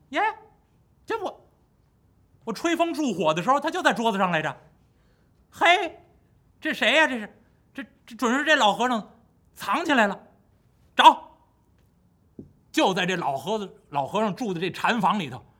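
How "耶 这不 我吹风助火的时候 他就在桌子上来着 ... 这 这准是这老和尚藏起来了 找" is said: much higher, quick and lively monkey voice; Monkey King. Acted. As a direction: snap into action like the Monkey King. (尖声活泼的孙悟空)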